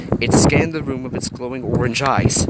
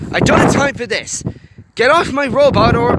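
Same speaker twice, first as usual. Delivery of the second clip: high, loud and frustrated; angry shout; 11 semitones higher, 5 dB louder than usual. A narrator's reading. Hiro yelling at the Gorg to free his robot, angry. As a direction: demand it angrily. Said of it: "his anger and confusion mixing".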